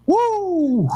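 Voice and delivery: drawn-out "woo" held up high; long high whoop. Real, not acted. Host reacts to what will happen to them, long whoop.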